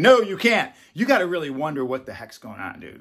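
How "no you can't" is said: higher, louder mock refusal; forbidding educator. Educators above refusing a teacher, mock stern. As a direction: act out a firm no from above. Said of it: "If the educators above you are saying".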